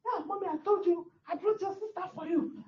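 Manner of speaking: high-pitched imitation of a delighted man; happy, retold. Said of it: "He was so happy".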